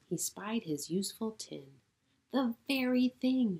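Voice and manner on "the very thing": pleased cry. Acted. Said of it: "he cried".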